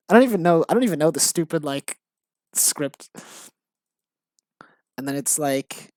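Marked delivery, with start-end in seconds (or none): none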